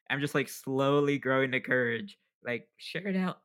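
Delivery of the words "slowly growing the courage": ordinary delivery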